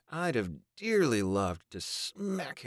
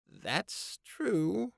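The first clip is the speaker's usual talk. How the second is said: high, timid woman's voice; pitched high and uneasy; Babe voice; 13 semitones higher than usual. A narrator's reading.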